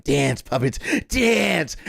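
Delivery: theatrical pretend command, climbing in pitch; mock puppet master. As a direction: play at gloating over your puppets. Plays gleeful puppet master toying with fans.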